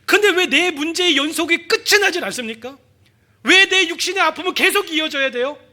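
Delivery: high, loud, pleading why to God; anguished prayer. Acted. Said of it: "우리 그럴 때 있잖아요".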